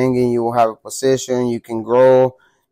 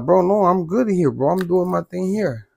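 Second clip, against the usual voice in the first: higher-pitched retold protest; pleading refusal; 5 semitones higher, 3 dB quieter than usual. Inmate telling the yard worker he is staying, mild protest. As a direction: mildly re-enact begging off, pitch raised.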